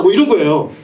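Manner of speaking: usual speaking voice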